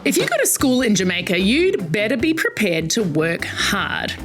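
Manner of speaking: usual speaking voice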